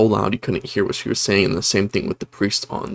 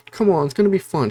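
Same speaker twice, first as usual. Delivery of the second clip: bubbly friend; 8 semitones higher, faster than usual. Retold or imitated. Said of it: "She's not a demon at this point".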